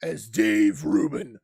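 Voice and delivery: loud hyped wrestling promo. Pretend. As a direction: growl it like a pro wrestler. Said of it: "I'll do it in my wrestling voice".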